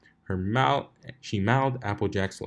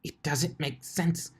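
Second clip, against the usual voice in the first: bewildered muttering; 6 semitones higher, 6 dB quieter, slower than usual. Acted. Twilight failing at the apple tree.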